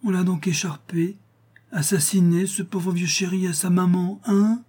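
high, whispery cooing falsetto; caressing falsetto. A narrator's reading. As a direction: coo to him like a baby in falsetto. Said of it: "prenant une voix de fausset des plus caressantes". (voix de fausset caressante, très aiguë)

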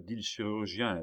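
plain reading voice

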